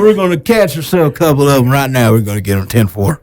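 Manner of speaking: high, hammed-up Southern good old boy; hammed-up country voice. Joint country voice bit.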